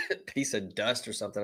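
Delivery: chuckling through the words; laughing. Real, not acted. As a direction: laugh it off while talking. Laughs off choking up on air.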